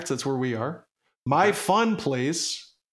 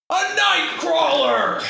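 high, loud punchline shout; shouted joke payoff; all caps shout; 12 semitones higher, 5 dB louder, slower than usual. Real, not acted. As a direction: yell the punchline like it's in capitals. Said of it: "this is in all caps".

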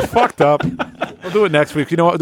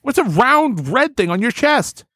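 mock amazement; 10 semitones higher than usual. Improv discovery of strange part.

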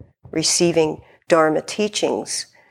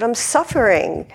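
earnest confession; 6 semitones higher than usual. Retold or imitated. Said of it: "I said".

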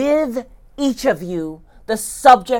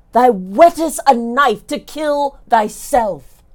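usual speaking voice

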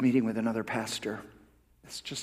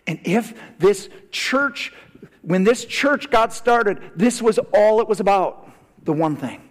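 emphatic preaching; 8 semitones higher, 8 dB louder than usual. Real, not acted. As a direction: drive each phrase home from the pulpit, pitched high. Preaches with raised voice.

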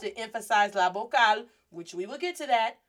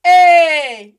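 loud, high eh; attention-getting shout; calling for attention; 4 semitones higher, 16 dB louder than usual. Shown as a demonstration. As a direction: holler a long eh at someone. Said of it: "Get his attention".

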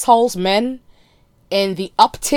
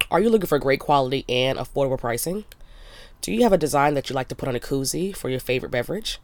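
lower, steady sponsor-read voice; 6 semitones lower, 5 dB quieter, faster than usual. Acted. Reading the Special Tees ad to listeners, as a steady ad read. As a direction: read the sponsor spot straight.

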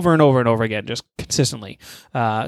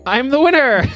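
triumphant; 10 semitones higher than usual. Real, not acted. Announces his win.

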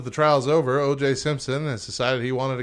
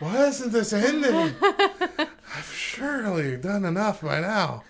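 slow, high mock moan; drawn-out plaintive whine through laughter; exhausted Olympians; 8 semitones higher, slower than usual. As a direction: drag the words out in pretend exhaustion. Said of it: "the Olympians are exhausted".

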